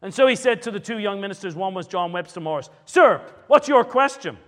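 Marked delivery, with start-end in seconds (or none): 2.8-4.3 loud, high, imperious demand; overbearing. Retold or imitated. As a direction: summon him like a stern, domineering elder. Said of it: "could be at times very overbearing".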